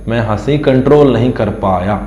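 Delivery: usual speaking voice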